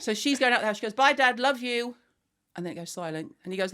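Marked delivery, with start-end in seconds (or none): 0.9-1.9 high, louder retold farewell; called-out goodbye. Daughter leaving the house after a row, high call. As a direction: toss it out on the way out.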